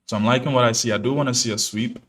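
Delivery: usual speaking voice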